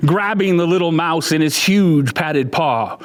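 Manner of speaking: usual speaking voice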